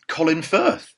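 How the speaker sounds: ordinary delivery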